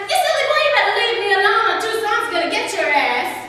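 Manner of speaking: shouted. Retold or imitated. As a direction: yell him off, fierce and high. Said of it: "and I shouted".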